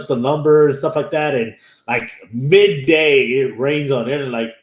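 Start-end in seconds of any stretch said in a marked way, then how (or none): none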